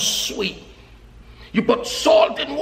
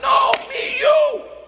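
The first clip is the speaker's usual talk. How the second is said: high, slow, ringing call; slow exhortation; slower than usual. Real, not acted. Preacher urges the congregation, high and slow. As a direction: lift it up and stretch it out.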